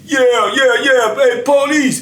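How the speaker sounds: high, calling out police over and over; mock barking. Inmate hollering for the police through the cell door, high mock bark. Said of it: "here he come again, barking".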